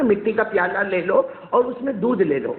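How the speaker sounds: usual speaking voice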